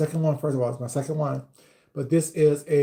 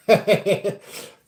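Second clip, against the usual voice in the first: loud laugh; 6 semitones higher, 7 dB louder than usual. Real, not acted. Laugh at heartburn.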